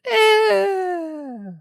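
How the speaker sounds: very high, odd-sounding dismissive eh. Retold or imitated. Shrugging off a thirteen-dollar game, very high eh. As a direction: toss off a squeaky high eh. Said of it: "'cause I was like".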